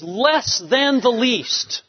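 preacher savoring the paradox, slow; slow and emphatic. Shown as a demonstration. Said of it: "one translation says".

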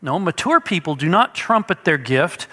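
normal talking voice

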